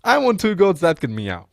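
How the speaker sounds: high, loud playful mock comeback; joking retort. Shown as a demonstration. Coach replays his joke answer to the lead, high and playful. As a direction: bounce the silly line back at them. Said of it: "You say something stupid, you get something stupid".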